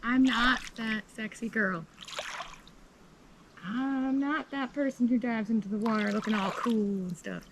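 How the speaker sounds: playful, keyed-up rant; excited. Real, not acted. Laughing at herself in water.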